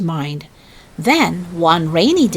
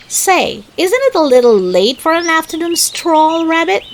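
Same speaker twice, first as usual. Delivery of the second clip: high, louder cheeky Tigger teasing; joking Tigger; 9 semitones higher, 4 dB louder than usual. A narrator's reading. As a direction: rib Rabbit with a high jokey lilt. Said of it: "Tigger joked".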